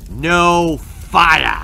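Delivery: loud, furious outburst; angry roar. A narrator's reading. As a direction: explode with rage. Angry fire chief erupts.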